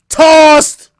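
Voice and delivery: loud barked ruling; shouted verdict. Pretend. Playing judge, he shouts the final ruling to chat. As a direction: slam it down like a gavel.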